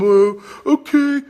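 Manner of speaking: a drawn-out, spineless "okay"; meek pushover. Retold or imitated. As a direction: play the meek husband who just gives in. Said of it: "I'm just not some".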